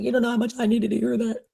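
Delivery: high, imitated tearful voice; bawling sister. Sister moved by hearing she's proud, imitated bawling. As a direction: voice her crying, pitched right up. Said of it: "she's really bawling".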